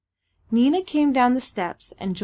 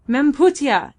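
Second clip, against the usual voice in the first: louder, higher call for attention; exclaimed; 5 semitones higher, 7 dB louder than usual. A narrator's reading. Babalatchi exclaiming to Nina for her attention. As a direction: exclaim it, raised in pitch and volume. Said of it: "exclaimed Babalatchi to Nina".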